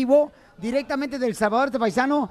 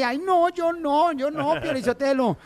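mock coy woman; 2 semitones higher, slower than usual. Host mimics coy women.

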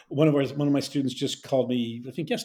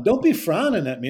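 higher, louder Pittsburgh demonstration; Pittsburgh accent; 6 semitones higher, 5 dB louder than usual. Shown as a demonstration. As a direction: show off the Pittsburgh dialect, punched up. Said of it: "So I would say".

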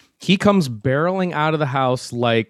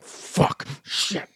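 muttered curse; 3 semitones higher, 7 dB quieter than usual. Retold or imitated. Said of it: "he's there just like".

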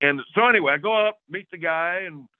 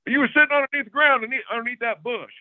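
fast, high disbelieving question; can't believe he sat under that bush; incredulous; 8 semitones higher, faster than usual. Retold or imitated. Hunter asking the old man about his bow spot, incredulous and fast.

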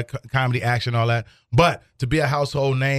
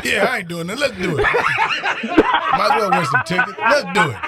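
laughing; 7 semitones higher than usual. Real, not acted. Laughing at the ticket giveaway.

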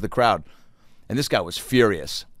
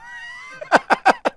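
high laugh. Real, not acted. Laughs at own joke.

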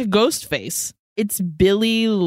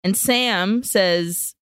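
ordinary talk

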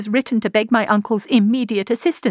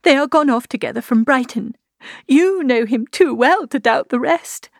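high, distressed voice, climbing toward despair; rising pitch, halting with distress; anguished confession; 7 semitones higher than usual. A narrator's reading. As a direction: break the dreadful news in mounting anguish. Distressed confession of sister's elopement.

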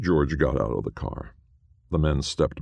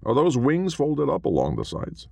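Chester voice; 12 semitones higher than usual. A narrator's reading. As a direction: lift into a light, wondering high voice. Chester's high character voice.

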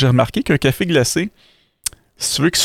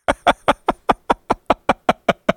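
breathy chuckle. Real, not acted. Laughs at own joke.

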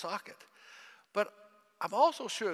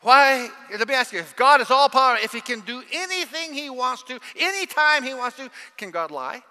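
loud, higher sermon build; preaching at full force; 7 semitones higher, 11 dB louder than usual. Acted. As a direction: hammer the question at the congregation.

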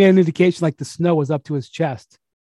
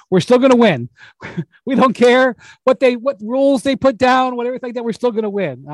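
higher and louder, cocky owner bravado; full-on Joe Lacob; 8 semitones higher, 3 dB louder than usual. Retold or imitated. Imitating the owner at an exit press conference, high and brash. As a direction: puff up like a defiant team owner promising wins. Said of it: "full on Joe Lacob".

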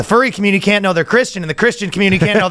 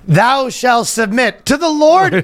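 preacher voice; 6 semitones higher than usual. Pretend. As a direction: preach it to the flock. Mock sermon to congregation.

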